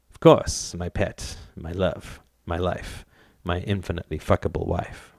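soft, low lover's murmur; tender endearments. A narrator's reading. Husband reaches for wife with endearments.

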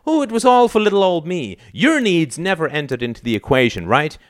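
high, bitter sarcasm; mock him with pitch way up; exaggerated sneering innocence; sarcastic mockery. A narrator's reading. Son mocks father sarcastically.

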